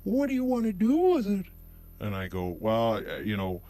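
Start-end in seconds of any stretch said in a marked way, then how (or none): 0.0-1.5 high, chirpy shop clerk voice; squeaky helpful salesperson; high bright mock clerk; Apple store clerk. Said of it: "And then they go".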